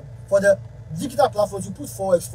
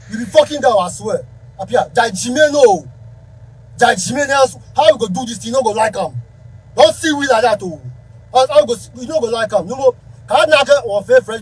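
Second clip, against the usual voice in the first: calling out angrily; 3 semitones higher, 9 dB louder than usual. Real, not acted. Calling out a fellow musician by name, loud and angry. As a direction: shout his name and confront him. Calling out [name] directly.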